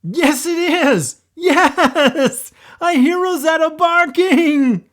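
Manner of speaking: high, loud joyful shout; high, loud yelling with a laugh; excited yell. Acted. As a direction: burst out with glee. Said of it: "Olaf yelled with excitement".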